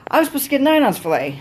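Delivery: high, fast whiny complaint; hammed-up outraged customer; mock freaking out. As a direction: whine it like a spoiled customer. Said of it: "I hear the dude freaking out".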